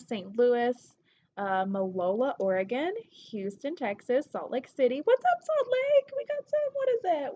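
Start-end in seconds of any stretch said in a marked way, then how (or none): 5.0-7.3 high-pitched, loud squeal of greeting; excited shout-out. Real, not acted. Shouting out a surprising city.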